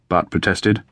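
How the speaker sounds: plain reading voice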